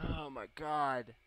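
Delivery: slow, loud exclamation; long, exasperated outcry; drawn-out dismay. Real, not acted. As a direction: groan it out loudly. Reacts to the game.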